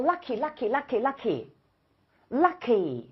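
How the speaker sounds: "lucky" said slowly, beat by beat; slow "lucky" to show its two syllables; "lucky" repeated slowly as "lu-cky"; syllable demo. Shown as a demonstration. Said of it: "其实lucky是两个音节".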